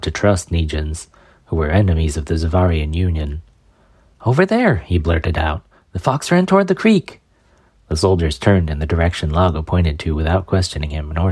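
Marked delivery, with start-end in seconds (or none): 4.2-4.8 high, urgent blurt; blurted call. Acted. Blurting to soldiers, pointing away. 5.9-7.2 high, hurried pointing out; urgent high voice. Acted. Misleading soldiers.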